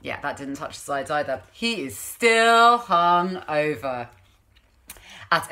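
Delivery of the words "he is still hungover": but he is still hungover, dragged out slowly; storybook refrain, dragged out. A narrator's reading. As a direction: draw out still like a weary chorus.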